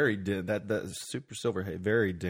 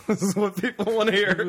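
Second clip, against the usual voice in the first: high, loud words through a laugh; 10 semitones higher, 4 dB louder than usual. Real, not acted. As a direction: laugh while you say it. Smoking weed on air, laughing.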